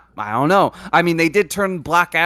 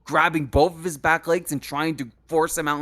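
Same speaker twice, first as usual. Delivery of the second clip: ordinary delivery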